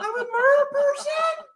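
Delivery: very high squealing voice; comic falsetto cry with laughter; hammed-up drowner. Acted drowner, playing along.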